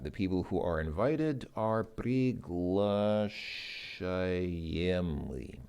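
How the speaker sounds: slow, deliberate teacher read; walk the learner through it slowly; slow careful explanation. Shown as a demonstration. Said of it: "passive form of that?".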